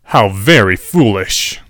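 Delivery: slow, dry condescending tone. A narrator's reading. Quirrell mocks Rita on the street, slow and dry. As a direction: look down on her as you say it. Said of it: "the man said dryly".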